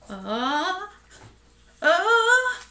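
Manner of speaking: rising, swelling "ah" before a sneeze; sneeze build-up. A narrator's reading.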